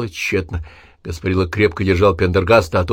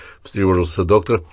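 ordinary delivery

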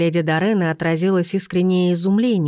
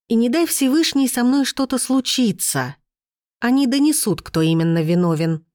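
higher, raised voice for all to hear; 6 semitones higher than usual. A narrator's reading. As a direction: call it out to the whole yard. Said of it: "повысила я голос".

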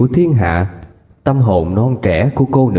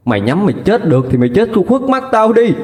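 high, fast, cutting fury; a furious mother disowning her daughter; bitter disowning; 6 semitones higher, faster than usual. Acted. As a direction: spit out the rejection.

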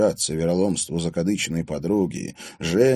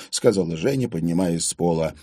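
usual speaking voice

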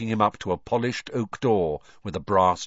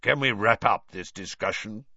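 growling interruption; growled. A narrator's reading. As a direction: growl to end the discussion. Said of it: "growled Moody".

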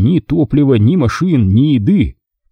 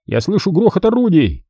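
urgent nudge, higher voice; waking a comrade; 8 semitones higher than usual. A narrator's reading. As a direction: rouse a sleeping friend urgently. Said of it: "сказал он".

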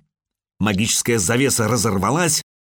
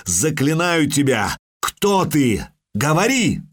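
solemn incantation; 4 semitones higher than usual. Acted. Чародейка заклинает, собирая силы.

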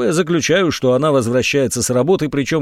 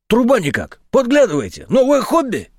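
mocking; 9 semitones higher than usual. A narrator's reading. Major mocks their spying telescope.

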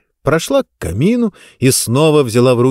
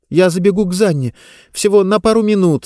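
eager pleading; 7 semitones higher than usual. Acted. Diba asks eagerly.

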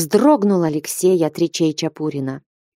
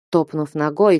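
plain reading voice

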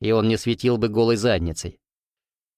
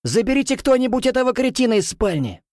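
shouting; 13 semitones higher than usual. Acted. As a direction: yell it down the hall. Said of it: "кричу".

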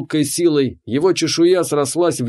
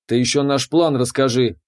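ordinary delivery, nothing marked

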